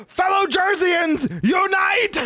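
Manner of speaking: high, drawn-out mock battle call; rallying cry. Streamer rallies fellow Jerseyans, high mock rallying cry. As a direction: call your people to arms, slow and high.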